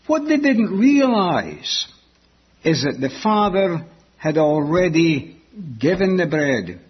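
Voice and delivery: ordinary talk, nothing marked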